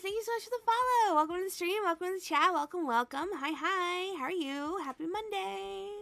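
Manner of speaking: high, fast streamer thanks; excited welcome. Real, not acted. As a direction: gush over the new follower. Welcoming new follower.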